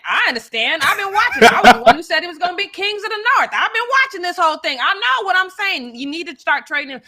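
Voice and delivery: high-pitched, worked-up protest; insistent, exasperated retelling; exasperated arguing. Retold or imitated. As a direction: re-enact a fed-up argument, voice riding high. Re-enacts an exasperated argument.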